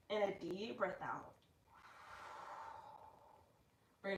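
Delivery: calm, soft guided yoga cues; soft yoga instructor. Acted. Yoga host guiding her livestream class through breaths, soft and calm. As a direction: ease the class through each breath gently.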